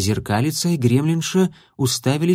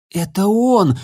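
higher, louder sudden cry; exclaiming; 8 semitones higher, 3 dB louder, slower than usual. A narrator's reading. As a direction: blurt it out. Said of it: "вскричал Милан".

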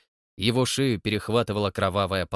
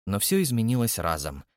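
plain reading voice

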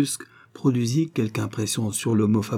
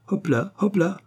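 high-pitched driver's call; high coachman's giddy-up; urging horses; 9 semitones higher than usual. A narrator's reading. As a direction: chirp the horses onward. Coachman driving off his horses.

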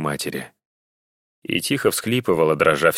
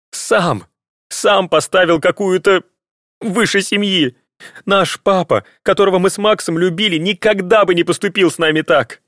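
high, loud, hurt shouting; angry outburst; 12 semitones higher, 5 dB louder than usual. Acted. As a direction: shout it out through tears. Daughter's tearful angry outburst.

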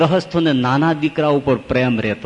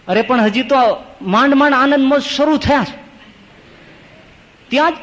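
indignant protest; 10 semitones higher than usual. Acted. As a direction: cry out that the fun has only just begun. Man protests death returning.

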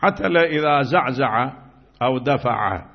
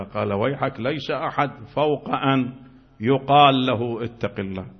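ordinary talk, nothing marked

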